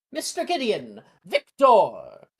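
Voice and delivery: loud winner announcement; announcer's cry. Acted. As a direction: proclaim the winner to the hall.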